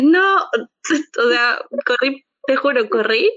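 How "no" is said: a sudden high, loud no; thrilled disbelief. Retold or imitated. Reading she got accepted, a high shocked no. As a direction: burst out in shocked joy.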